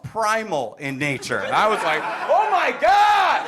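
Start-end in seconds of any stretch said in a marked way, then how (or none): 2.3-3.5 very high, loud, slow shriek; stretched-out high yell of shock; panicked scream. Retold or imitated. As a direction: scream in horror, half laughing. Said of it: "I started screaming".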